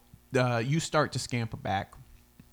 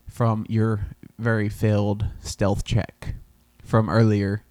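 ordinary talk, nothing marked

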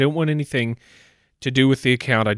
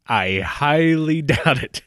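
laughing; 3 semitones higher, much slower than usual. Acted. Caller laughs off comeback offer.

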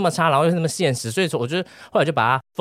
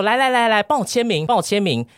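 lightly imitated eager grandma; 8 semitones higher than usual. Grandma rushes the grandson for an autograph, light eager grandma impression. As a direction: hurry him over for an autograph, pitched up.

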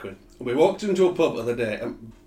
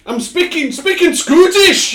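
hammed-up bad Scottish accent, much higher and louder, breaking into a laugh; mock the son's awful Scottish accent, pitched way up; terrible Scottish accent; 13 dB louder, slower than usual. Dad mimicking his son's terrible Scottish accent in a pub, high, loud and laughing. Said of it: "He does a terrible Scottish accent".

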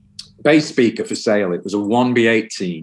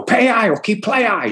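much higher, urging call; 11 semitones higher than usual. Retold or imitated. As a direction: egg him on, all enthusiasm. Ian urging the bassist.

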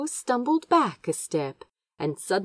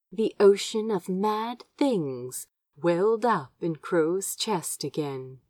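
ordinary delivery

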